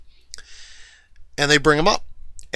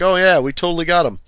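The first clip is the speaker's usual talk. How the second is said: higher, louder cocky boast; mock confidence; 7 semitones higher, 5 dB louder than usual. Navy reporting after depth charging the monster, mock confidence. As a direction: imitate someone far too sure of success.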